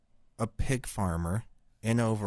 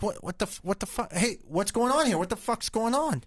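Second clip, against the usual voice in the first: alarmed; 11 semitones higher than usual. Retold or imitated. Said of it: "And Jeff's like".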